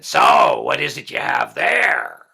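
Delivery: loud harsh hag voice, slow and drawn out; booming slow hag demand; troll queen hag. A narrator's reading. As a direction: bellow it like a greedy troll queen. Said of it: "the Troll Queen hag said".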